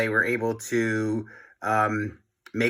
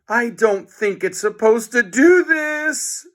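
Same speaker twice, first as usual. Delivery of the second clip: higher, louder shout over noise; 12 semitones higher, 5 dB louder, slower than usual. A narrator's reading. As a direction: yell above the roaring machine. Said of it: "shouted George over the horrible noise".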